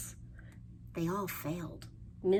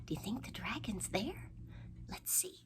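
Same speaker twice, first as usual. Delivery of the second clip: quick hushed whisper; fast secret whisper; 3 semitones higher, 7 dB quieter, faster than usual. Acted. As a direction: whisper it, building suspense. Hushed suspense before reveal.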